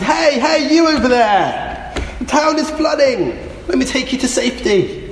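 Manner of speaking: high-pitched hail from a rowboat; shouting. Acted. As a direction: call out urgently to someone over there. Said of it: "came along and shouted".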